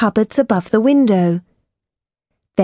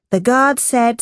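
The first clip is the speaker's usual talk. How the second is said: ordinary delivery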